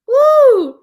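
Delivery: loud high woo; loud high whoop. Real, not acted. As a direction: let out a whoop.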